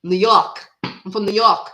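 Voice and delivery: playful attempt at a New Yorker's accent; imitated New York accent. Posing as a New Yorker for viewers, imitated New York accent. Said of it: "I love the New Yorker's accent too".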